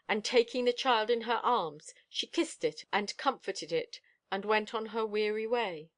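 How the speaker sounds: plain reading voice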